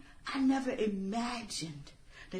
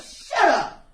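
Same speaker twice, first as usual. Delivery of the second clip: much louder, snapping shout; furious outburst; 9 semitones higher, 13 dB louder than usual. Acted. Killer shouts down the mean girl, furious. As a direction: cut her off with a furious shout. Outburst cutting off her rival.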